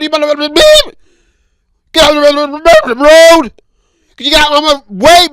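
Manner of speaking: loud, high furious yelling at a driver; road rage rant. Pretend. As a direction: scream it out the car window. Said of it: "the things that you would say".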